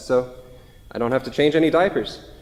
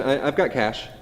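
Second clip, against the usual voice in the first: normal talking voice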